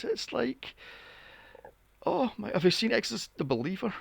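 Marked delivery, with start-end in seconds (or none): none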